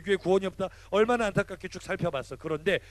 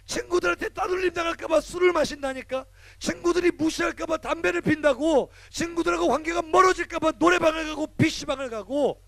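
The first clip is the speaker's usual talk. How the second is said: higher and louder, urgent warning; fired-up preaching; 9 semitones higher, 5 dB louder than usual. Acted. Pastor warns teens about school peer pressure, fired-up. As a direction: preach it hard, build the urgency.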